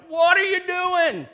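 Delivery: very high, slow, full-volume screech; outraged donkey. Acted. Beaten donkey to Balaam, shrieking protest. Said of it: "the donkey's like".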